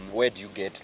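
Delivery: ordinary delivery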